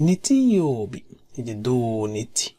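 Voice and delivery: normal talking voice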